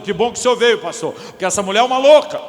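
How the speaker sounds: a husband venting to the pastor; mock indignant husband; angry husband. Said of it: "penso no marido bravo".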